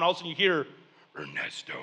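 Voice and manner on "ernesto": very low, hushed ghostly call; haunted house voice. Acted. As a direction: creep them out with a deep, soft summons. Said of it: "going into a haunted house".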